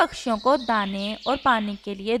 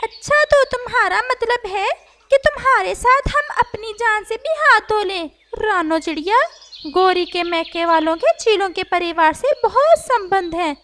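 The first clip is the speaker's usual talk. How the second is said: higher parrot voice, exasperated; alarmed parrot; 8 semitones higher, 5 dB louder than usual. Acted. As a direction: refuse the risky plan. Parrot refuses crow's plan.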